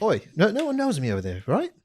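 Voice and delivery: lightly acted British accent. Telling his friend nobody knows him there, British accent. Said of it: "Talked to him in a British accent".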